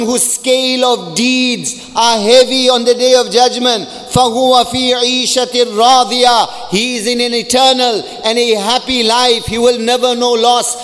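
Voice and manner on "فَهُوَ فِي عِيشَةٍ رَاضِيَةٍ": fast chanted Arabic verse; quick Quranic recitation. Acted.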